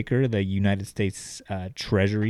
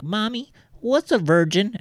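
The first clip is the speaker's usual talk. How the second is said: imitated little girl, much higher voice; young daughter; 12 semitones higher than usual. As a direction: put on a high child's voice. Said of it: "her eleven-year-old daughter came up".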